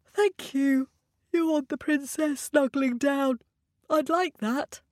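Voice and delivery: high, pleased little princess; cartoon princess. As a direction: sweetly thank your new friend. Princess thanks frog.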